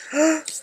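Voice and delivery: sharp, breathy gasp of delight; excited gasp. Real, not acted. Crafter thrilled by her little envelope of scraps, excited gasp. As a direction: gasp at a treat in the mail.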